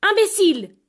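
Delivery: loud, high outraged cry; wife explodes at her foolish husband; furious insult. Acted. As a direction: hurl the insult at him. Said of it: "La femme était très indignée".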